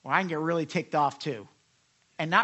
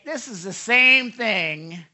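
loud, raised mock complaint; objecting scholar; 3 semitones higher, 6 dB louder than usual. Preacher voices scholars questioning the repeat, mock complaint. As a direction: lightly act a sceptic's protest. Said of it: "Some scholars will say".

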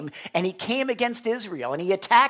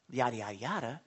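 dismissive yada; 7 semitones lower, 8 dB quieter, faster than usual. Shown as a demonstration. Dismissive yada meaning nothing.

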